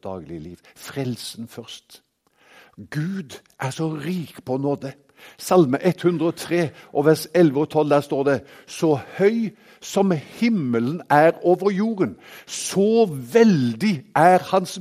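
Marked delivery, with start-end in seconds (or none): 2.9-8.4 raised, louder pulpit voice; ringing sermon delivery; full-force preaching. Acted. Preaching on grace with scripture. 8.7-12.2 proclaimed scripture. Acted. Reading Psalm 103 aloud.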